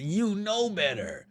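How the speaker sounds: slow, drawn-out scolding of oneself; self-reproach. Retold or imitated. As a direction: tell yourself off slowly. Scolding himself on drive home.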